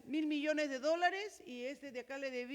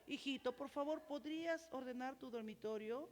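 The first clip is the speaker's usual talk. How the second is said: put-on much softer, kind asking of a child; gentle request; 8 dB quieter than usual. Shown as a demonstration. Parent gently asking a child to tidy the room, soft and kind. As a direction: coax the child tenderly. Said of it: "nuevas maneras de hablar".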